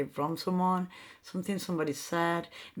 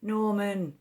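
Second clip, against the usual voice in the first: usual speaking voice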